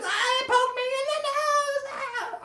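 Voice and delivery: high mock baby wail; hammed-up crybaby whine over a poked nose; crying like a baby. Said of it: "crying like a little baby".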